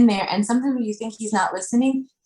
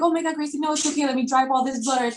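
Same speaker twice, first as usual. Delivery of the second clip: higher, soothing mock-panicked mom voice; worried mom; 6 semitones higher, faster than usual. Mother imagining fussing over her son's cut, higher. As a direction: play the fussing mother calming her child. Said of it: "instead of me saying".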